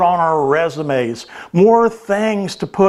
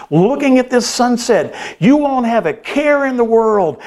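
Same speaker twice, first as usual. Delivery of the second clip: higher, exasperated outburst; mock shout; 7 semitones higher than usual. A narrator's reading. Businessman shouting at the fisherman, exasperated. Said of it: "so mad at this point that he shouted".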